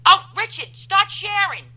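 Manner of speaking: high-pitched nagging orders; hammed-up exasperated bossing; mock scolding. Mock scolding of Richard for not sharing.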